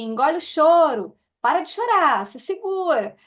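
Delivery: scolding mother. Retold or imitated. Mothers telling a child to swallow the tears, imitated scolding. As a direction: mimic a mother ordering a child to stop crying. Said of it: "São mães que dizem assim".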